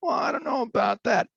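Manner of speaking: high, doubtful stalling voice; an official stalling a town group; mock skeptical official. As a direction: play a reluctant bureaucrat dodging. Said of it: "when some entities say".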